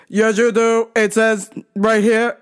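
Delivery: deliberate mock deaf man's voice; yelling deaf customer. Deaf customer points out Wi-Fi to worker, imitated yelling. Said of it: "Just yelling, man".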